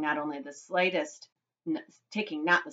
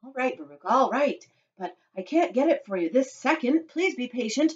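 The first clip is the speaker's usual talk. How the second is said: higher-pitched, pleading patience; placating; 6 semitones higher than usual. A narrator's reading. Father to spoilt daughter, placating. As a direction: give in, trying to soothe.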